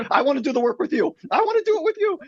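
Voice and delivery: very high, hammed-up eager plea; mock-squeal it like an overjoyed husband; over-eager blurting. Husband seizing on his wife's sign, hammed-up eagerness.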